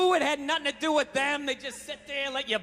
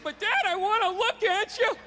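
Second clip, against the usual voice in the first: put-on higher, whiny little kid; imitating kid; 8 semitones higher than usual. Son pleading with his father, kid voice.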